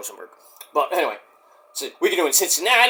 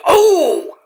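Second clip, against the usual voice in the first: surprised oh; 13 semitones higher, 5 dB louder than usual. Real, not acted. Spots wrestler on screen.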